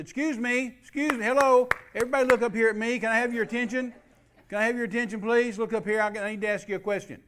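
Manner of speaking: loud, high call to the room; calling for attention. Retold or imitated. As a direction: grab the whole congregation's attention. Preacher calling congregation to attention.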